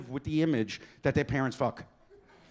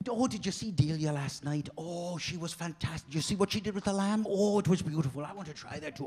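bad Irish accent of an old lady, softer; chatty Irish granny voice, badly done; bad Irish old woman; 3 dB quieter than usual. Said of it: "I'm shit at accents".

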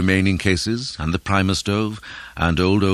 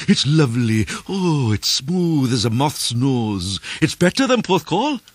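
high, loud whooping; delighted cries; 10 semitones higher, 6 dB louder than usual. A narrator's reading. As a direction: cry out in rapture while paddling. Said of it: "they cried".

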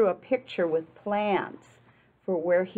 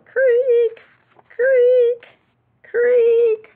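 high, loud drawn-out creak; creaking sound; 10 dB louder, much slower than usual. A narrator's reading. Reading the breaking playground to kids, high drawn creaks. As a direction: stretch the creak eerily.

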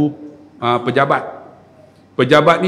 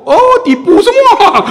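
meleret tinggi dan lantang; seruan terkejut; 8 dB louder, slower than usual. Retold or imitated. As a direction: jerit kerana baru tersedar ditipu. Tersedar semua jemaah berlakon. (seruan terkejut yang dibuat-buat untuk berjenaka)

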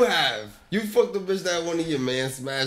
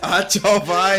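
louder, higher Puerto Rican exclamation; Puerto Rican accent; 5 semitones higher, 5 dB louder, slower than usual. Pretend. Puerto Rican voice echoing the line to friends, loud. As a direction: belt it out Boricua style. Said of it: "how would you say it in Puerto Rican".

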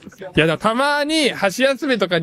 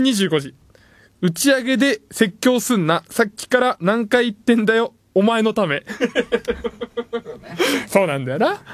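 normal talking voice

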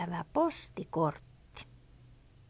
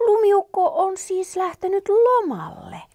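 high loud slow amazed bunny; delighted wonder; 11 semitones higher, 6 dB louder, slower than usual. Acted. Bunny delighted that snowman holidays, high and slow. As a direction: savour the happy news.

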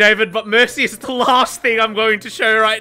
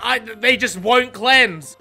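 ordinary talk, nothing marked

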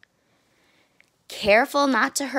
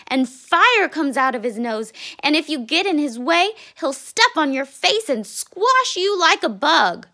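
put-on loud, higher, breakneck child's alarm; screeching; 6 semitones higher, 6 dB louder than usual. A narrator's reading. Chester warns mother about the bully, half screeching. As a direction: pile up the horrors in an excited cry. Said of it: "screeched Chester".

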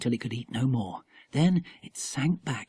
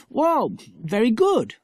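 airily; 8 semitones higher, slower than usual. A narrator's reading. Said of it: "said Jack airily".

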